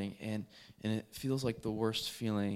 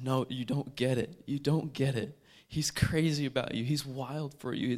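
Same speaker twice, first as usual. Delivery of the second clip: loud, pressing, fervent persuasion; urgent retold plea, punching each phrase; impassioned insistence; 3 semitones higher, 6 dB louder than usual. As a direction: insist on it with raised voice.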